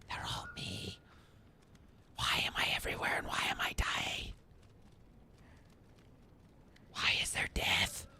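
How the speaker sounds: scared hushed whisper. Real, not acted. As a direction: breathe the words, afraid to be heard. Whispering among creepy bodies.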